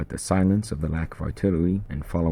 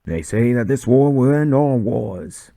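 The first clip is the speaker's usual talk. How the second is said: loud, slow, high-pitched sergeant's address; sergeant rallying troops; 8 semitones higher, 7 dB louder, slower than usual. Acted. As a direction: bellow it across the trench like a drill sergeant. Sergeant addresses men in trench.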